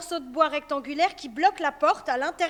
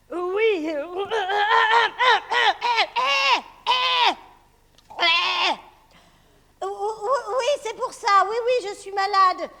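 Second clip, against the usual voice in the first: high, loud, stammering; faking sick wolf; 5 semitones higher, 8 dB louder, slower than usual. Acted. Wolf flustered, faking being ill. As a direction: hem and haw, caught out.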